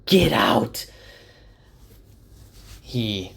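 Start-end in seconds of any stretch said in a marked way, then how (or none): 0.0-0.9 higher, louder shout; yelling. A narrator's reading. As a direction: yell at the stranger to leave.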